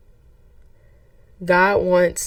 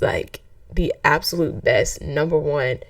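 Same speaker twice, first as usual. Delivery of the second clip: ordinary talk, nothing marked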